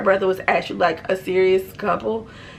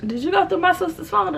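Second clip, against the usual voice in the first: high, lightly voiced boyfriend; boyfriend's question; 10 semitones higher than usual. Boyfriend asks narrator, lightly voiced high question. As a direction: ask it stunned and high. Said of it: "he's just like".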